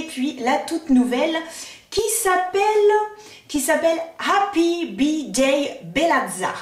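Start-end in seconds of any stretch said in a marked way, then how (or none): 4.2-6.5 slow, sung-out Italian pronunciation of Happy B-Day Bellazza; mock Italian accent on the brand name. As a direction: savour the name with an Italian lilt. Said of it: "l'accent italien, j'adore".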